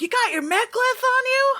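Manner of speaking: put-on very high, excited child's question; eager kid. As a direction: ask like a thrilled youngster. Game master acts eager kid.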